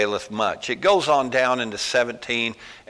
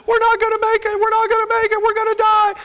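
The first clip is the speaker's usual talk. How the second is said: very high, loud mock-hysterical wail; hammed-up movie panic; 8 dB louder than usual. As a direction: overplay a terrified movie character. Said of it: "the person's over there going".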